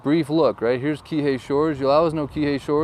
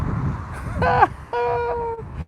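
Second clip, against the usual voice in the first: high squealing laugh; 4 dB quieter than usual. Real, not acted. Laughs at the name Kamaole.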